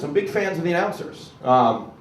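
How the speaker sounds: ordinary talk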